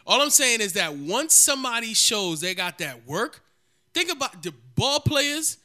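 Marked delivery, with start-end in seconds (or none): none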